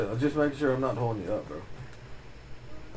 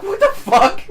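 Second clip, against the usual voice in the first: laughing, shouted disbelief, pitched way up; incredulous outburst; 10 dB louder than usual. Retold or imitated. Telling a friend about his sister's request, laughing shout. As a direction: burst out laughing in shock at the ask.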